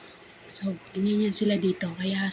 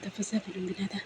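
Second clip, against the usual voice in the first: ordinary talk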